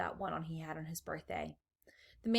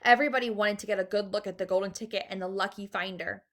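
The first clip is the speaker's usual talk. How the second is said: plain reading voice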